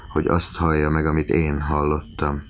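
usual speaking voice

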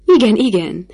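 a loud happy chorus of yes; calling out. A narrator's reading. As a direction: shout it out with glee. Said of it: "kiáltoztak a fiatalok".

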